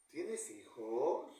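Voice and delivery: slow question rising at the end on hijos. Shown as a demonstration. Teacher showing students a rising yes-no question. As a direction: ask ¿Tienes hijos? slowly with the voice climbing on hijos. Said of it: "Mi entonación está ascendiendo".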